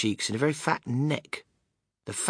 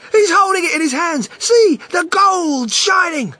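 excited shout; 11 dB louder than usual. Acted. Crowd member calling out.

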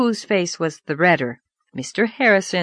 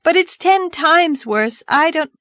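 put-on distressed girl; 10 semitones higher, 4 dB louder than usual. Anne distressed.

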